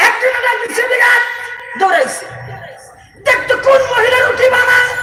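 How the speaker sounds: a roaring waz preacher calling out over the crowd; fiery preaching. Acted. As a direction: preach it at full force to the crowd. Preacher shouting the story.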